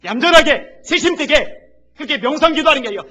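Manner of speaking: loud, high impassioned preaching; shouting preacher building to a peak; fiery preaching. Real, not acted. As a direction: drive it home at full force. Preaching rising to full force.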